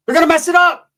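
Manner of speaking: squeaky sing-out for comic emphasis; high comic exclamation; high mock voice. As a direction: jump way up in pitch.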